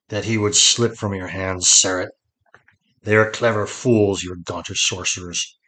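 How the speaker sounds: dry lord addressing his lady; dry, level scorn with firm stresses; dry-voiced. A narrator's reading. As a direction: keep it dry and even-pitched. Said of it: "said dry-voiced to his lady".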